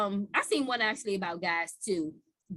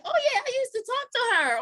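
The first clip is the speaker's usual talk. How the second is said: mock casual voice; 5 dB louder than usual. Mock quote of casual denial.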